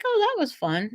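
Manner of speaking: high, breezy mock mom; mom's breezy quote. Mom shrugging off the stuck elevator, breezily. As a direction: play it off as harmless fun. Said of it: "she was like".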